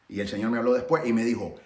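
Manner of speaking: usual speaking voice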